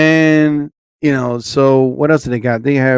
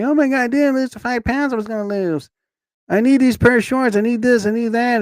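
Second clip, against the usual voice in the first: put-on whiny teen voice; 9 semitones higher than usual. Mimicking spoiled kids before school.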